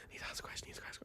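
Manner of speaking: whispered panicky mantra. Retold or imitated. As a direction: whisper it to yourself. Panicked inner thought.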